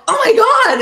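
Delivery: high, loud squeal of horror; mortified outcry. Retold or imitated. Actress reliving embarrassment before her co-star, mortified outcry. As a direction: act out a horrified shriek.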